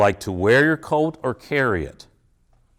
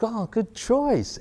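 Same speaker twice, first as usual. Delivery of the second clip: warm praise; 9 semitones higher, 6 dB quieter, slower than usual. Shown as a demonstration. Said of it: "Do you hear the difference?".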